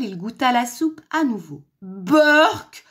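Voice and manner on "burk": loud, high yuck; disgusted yell. A narrator's reading. Wolf tasting the soup again, disgusted yell. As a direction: bellow your disgust at the taste. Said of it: "hurla-t-il".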